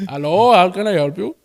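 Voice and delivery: put-on Indian-accented call center greeting; mock Indian accent. Tech support greeting a caller, mock Indian accent. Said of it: "I always do a tech support".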